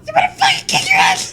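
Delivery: shrill, fast, very high scream; screeching yell. Acted. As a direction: screech it like a furious harpy. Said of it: "those lovely dulcet [name] tones".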